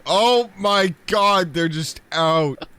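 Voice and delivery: loud, high, stretched-out shock; shocked outburst. Pretend. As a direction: cry it out slowly in amazement. Shocked reaction to the game.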